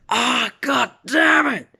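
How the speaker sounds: high, loud acted cry of frustration; pained yell. Villain struck in the eye by a rock, pained yell. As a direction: yelp it like you've just been hit.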